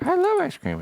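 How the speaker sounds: put-on high girly voice. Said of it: "when a girl's like".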